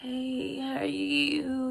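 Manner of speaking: sweet, slow little greeting; imitating her own flirty cute hello; mock cute voice. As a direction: put on a cutesy voice to charm him. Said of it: "I was trying to sound so cute".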